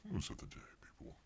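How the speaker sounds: breathy whispered repeat of news of the day. Acted.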